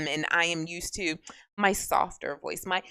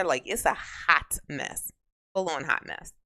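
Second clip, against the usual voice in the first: ordinary delivery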